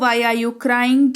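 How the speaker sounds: very slow and clear; slow model question. Shown as a demonstration. Teacher slowly models the question for students. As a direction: say it slowly for repeating. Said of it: "तुम क्यों रो रही हो?".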